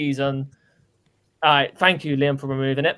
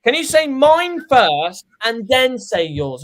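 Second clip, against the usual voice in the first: higher, slow, drawn-out imitation; mock demanding teammate; 5 semitones higher, slower than usual. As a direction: spell out the demand with exaggerated insistence. Mimicking Liam wanting his score first.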